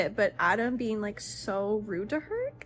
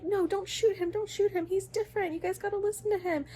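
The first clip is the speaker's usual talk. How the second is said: put-on high, pleading, panicked girl voice; frantic pleading girl; 11 semitones higher than usual. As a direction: beg them in a high frantic voice.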